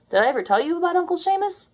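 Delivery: high, fast and eager; eager question. Acted. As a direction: rush it out, eager to tell. John voiced, eager.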